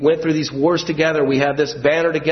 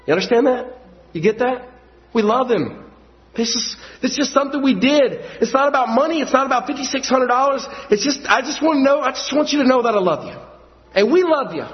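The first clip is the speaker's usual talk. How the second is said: preaching at full force; 11 semitones higher than usual. Real, not acted. Preacher stirs the congregation, impassioned high preaching. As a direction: lift it up and pour your heart out to the church. Preacher rising again, pleading.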